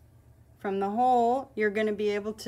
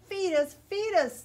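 put-on frowning baby birds; 9 semitones higher than usual. A narrator's reading. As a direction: whine like sulky nestlings. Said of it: "the little ones frown".

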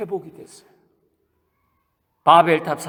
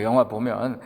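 usual speaking voice

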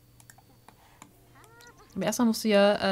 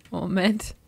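normal talking voice

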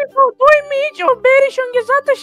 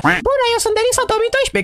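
soft, put-on girl voice; rehearsing a falsetto girl voice; practising a girl voice; 3 semitones lower, 4 dB quieter than usual. Shown as a demonstration. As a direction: try out the disguise voice quietly. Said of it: "să-mi antrenez și eu vocea".

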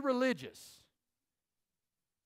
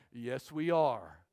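smug leaders; 9 semitones lower, slower than usual. Retold or imitated. Leaders quoted smugly agreeing.

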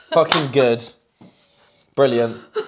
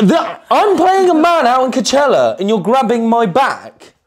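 high, loud telling-off; mock exasperation; 7 semitones higher, 4 dB louder than usual. Coach scolding friend for grabbing his back, mock exasperated. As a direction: protest in exaggerated disbelief. Scolds her for failing the game.

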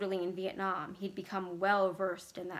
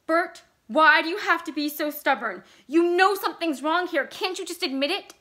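loud, high-pitched pleading; exasperated outburst; 8 semitones higher, 10 dB louder than usual. A narrator's reading. Vicky begging stubborn Bert to admit it, exasperated. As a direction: press him hard, loud and high.

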